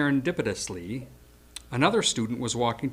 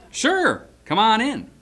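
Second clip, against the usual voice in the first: cheerful welcome; 9 semitones higher, 6 dB louder, slower than usual. Retold or imitated. Paul welcomes the moocher in.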